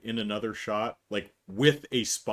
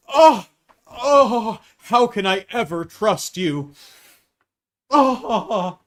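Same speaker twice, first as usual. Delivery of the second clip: much higher, louder hammed-up wail; melodramatic mock whine; 8 semitones higher, 11 dB louder, much slower than usual. Mocking Mike's overreaction, high melodramatic wail. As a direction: overplay the betrayed hero. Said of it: "he finds".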